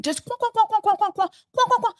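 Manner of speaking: high mock quacking, like a squawking bird; mocking duck quacks. Commenter mocking [name] as a chirping bird, high quacks. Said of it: "Just, just chirping".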